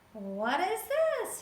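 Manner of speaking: high, slow puzzled voice of Beryl; Beryl asking. A narrator's reading. Beryl voiced in told story.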